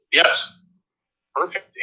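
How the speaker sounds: ordinary talk, nothing marked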